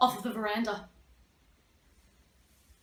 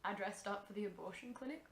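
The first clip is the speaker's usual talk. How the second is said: quiet monotone; 2 semitones lower, 12 dB quieter than usual. Acted.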